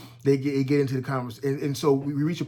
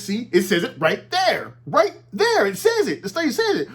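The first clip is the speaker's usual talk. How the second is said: higher and louder, jabbing at the words; mock Rogan insisting; 8 semitones higher, 4 dB louder than usual. Imitating Joe Rogan driving the point home to Sanjay Gupta, higher and louder. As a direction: imitate Joe Rogan pressing hard and triumphant. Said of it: "Joe Rogan says".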